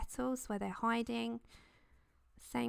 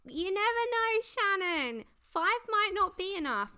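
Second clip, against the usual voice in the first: high playful voice; 9 semitones higher, 8 dB louder than usual. Acted. Playful remark to colouring pencil.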